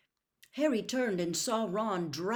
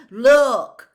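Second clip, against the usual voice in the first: loud high demand; urgent shout; 7 semitones higher, 21 dB louder than usual. A narrator's reading. Ron forcing Snape to see Hermione, urgent shout. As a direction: shout it at him.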